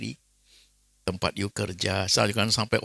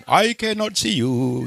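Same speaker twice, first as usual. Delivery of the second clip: higher, slow, strained patient's voice; imitating fading patient; 11 semitones higher, 6 dB louder, slower than usual. Preacher retelling the ICU case, imitating a patient's slow voice. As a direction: voice a dying patient straining to see. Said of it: "gitu ya".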